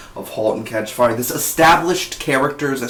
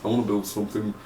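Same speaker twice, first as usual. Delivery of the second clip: a hint of Joe's voice, softer and trailing off; half-hearted Joe impression that gives up mid-line; 2 semitones lower, 8 dB quieter, faster than usual. Reviewer trying Joe's pitch, quietly losing it. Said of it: "uh, Joe voice".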